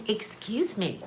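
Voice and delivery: soft, prim "Excuse me." in a fussy clerk's voice; quiet, clipped politeness from a fussy clerk; persnickety clerk. Retold or imitated. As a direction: play a prissy, fussy shop clerk. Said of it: "he's very persnickety".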